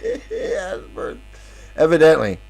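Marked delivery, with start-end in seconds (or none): 0.1-1.2 real giggle squeezed into the words; giggling. Real, not acted. Speaker amused by his own joke, giggling. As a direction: crack up while repeating the joke.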